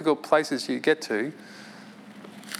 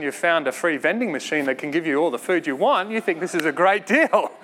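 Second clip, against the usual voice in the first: ordinary talk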